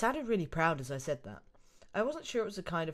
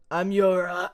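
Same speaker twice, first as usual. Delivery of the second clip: Krauser voice; 10 dB louder, slower than usual. Acted. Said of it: "let me get the Krauser voice on".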